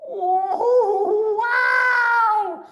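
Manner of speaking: very high, loud amazed cry; first gulp of strong cider; excited shout. Acted. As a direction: cub shouting after his first gulp. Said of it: "he shouted".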